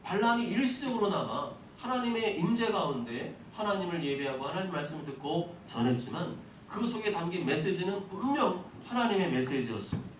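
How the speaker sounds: ordinary delivery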